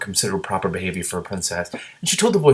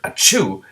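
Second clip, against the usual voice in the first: sneeze; 5 dB louder than usual. Acted. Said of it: "Every time the gray green, green dragon sneezed".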